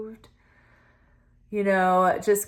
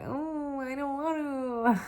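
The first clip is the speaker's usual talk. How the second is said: whiny reluctance; 6 semitones higher, 6 dB quieter, slower than usual. Pretend. Voicing reluctant inner self.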